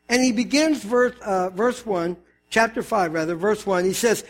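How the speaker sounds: ordinary delivery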